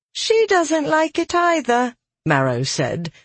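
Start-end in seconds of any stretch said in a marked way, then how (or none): 0.1-1.9 high, drawn-level sleepy voice; yawning. A narrator's reading. Marrow rolling over in bed, yawning. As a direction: say it high, through a yawn. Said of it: "yawning as she rolled over".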